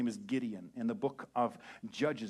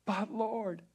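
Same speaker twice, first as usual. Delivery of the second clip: higher whining protest; whiny Gideon; 10 semitones higher, 3 dB louder than usual. Retold or imitated. Gideon protesting to God, whiny. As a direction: plead like a reluctant kid. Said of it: "Gideon replied".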